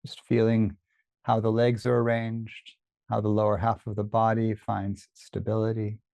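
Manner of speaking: unhurried body-scan guidance with long pauses; guided meditation. Real, not acted. Teacher leads listeners through a body scan, calm meditation voice. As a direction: guide attention gently, leaving space after each phrase.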